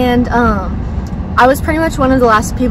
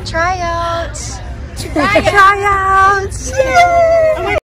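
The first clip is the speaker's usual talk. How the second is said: excited cheering; 8 semitones higher, much slower than usual. Real, not acted. Cheering arrival at cheer tryouts.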